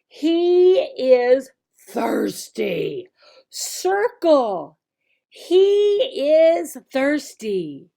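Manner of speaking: each word drawn out and clear; slow classroom drill voice. Shown as a demonstration. Teacher drilling he is thirsty with a child, slow. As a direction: model it slowly like a kids' teacher.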